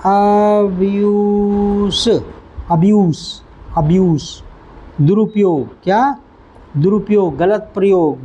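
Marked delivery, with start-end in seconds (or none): 0.1-4.2 अब्यूज़ said slowly and clearly, stress on the second syllable; teacher modelling अब्यूज़ slowly; pronunciation demo. Shown as a demonstration. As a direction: spell out अब्यूज़ sound by sound. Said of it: "पहली बात तो उच्चारण इसका ये है".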